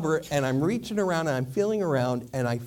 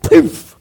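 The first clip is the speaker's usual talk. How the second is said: loud "poof" of breath; sharp blowing puff; 14 semitones higher, 16 dB louder than usual. Retold or imitated. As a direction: blow out a candle with a hard puff. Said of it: "And I went".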